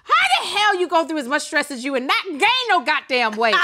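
high, loud, incredulous tirade; mock outrage. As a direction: belt it out in playful disbelief. Mock outrage at friend staying slim.

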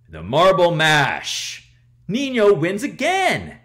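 loud, high announcer cheer; ringside announcer. Acted. Announcer cheering Nino's win, loud and high. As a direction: call it like a wrestling announcer. Announcer hails the winning move.

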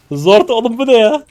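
high, loud shocked yelp; shocked outburst. Real, not acted. Streamer yelps at teammates over a sudden play, shocked. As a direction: yelp it as the game surprises you.

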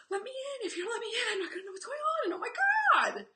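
plead, half laughing; desperate plea. Retold or imitated. Parent begging rejected.

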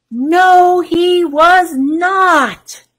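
high, loud, stretched NO, HE WAS NOT; emphatic refrain. Acted. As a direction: draw out every word of "No, he was not". Storyteller's emphatic refrain answer.

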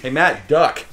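shouted warning. Real, not acted. Player warns Matt to duck, shouted warning. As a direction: call out loud, urgently. Sudden warning during the game.

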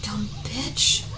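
soft whispered scorn; whispered insult. Real, not acted. Gamer whispering an insult at the game, scornful. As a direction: hiss it under your breath.

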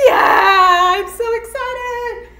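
loud joyful yell; excited. Real, not acted. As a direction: shout it with glee.

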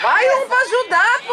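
shouting. Real, not acted. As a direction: bellow an order across the pitch. Coaching from the sideline.

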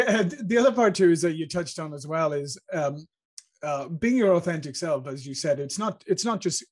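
normal talking voice

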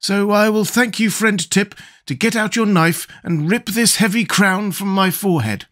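a cheerful scarecrow thinking aloud. Acted.

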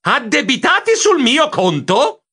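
high, outraged question then exclamation; indignant disbelief. A narrator's reading. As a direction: blurt it out aghast at the bill. Outraged at being charged. (incredulo e indignato)

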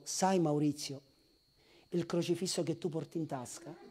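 soft, low, intimate counsel; God speaking gently. Retold or imitated. God telling Maurizio to drop his charms, soft. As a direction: speak to him like a close friend. God's words voiced.

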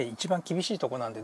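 normal talking voice

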